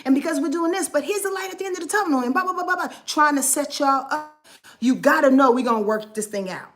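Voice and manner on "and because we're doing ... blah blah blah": high, fast rattled-off reassurance; higher, quick parody of her own positivity; mock pep talk. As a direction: rush through it like a tired script. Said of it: "give y'all some variables".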